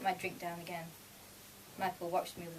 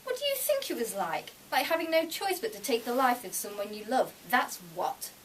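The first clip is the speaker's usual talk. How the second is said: sharp retort; 5 semitones higher, 6 dB louder than usual. A narrator's reading. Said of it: "Michael's eyes snapped onto mine".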